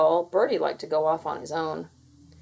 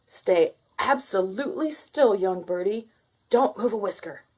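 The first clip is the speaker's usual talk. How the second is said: urgent instruction. Acted. Father instructs Bertie.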